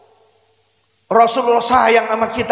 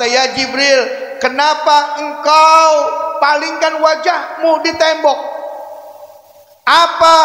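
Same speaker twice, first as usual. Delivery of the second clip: slow pleading question; slower than usual. Acted. Prophet questions Jibril slowly.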